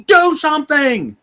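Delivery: high, loud desperate cry; mock scream. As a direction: yell it like a panicked crowd begging for help. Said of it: "and then we scream".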